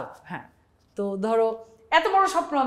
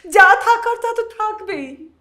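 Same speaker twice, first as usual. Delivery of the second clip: slow, loud, high declaiming stage voice; jatra dialogue; 6 semitones higher, 6 dB louder than usual. Acted. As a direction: proclaim it to a village crowd. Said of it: "যাত্রার dialogue মায়ালগ করতে হবে".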